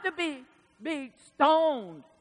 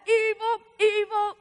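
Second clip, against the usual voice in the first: mock taunt; 7 semitones higher than usual. Kids taunting a woman, high mock chant. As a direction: taunt it like a child.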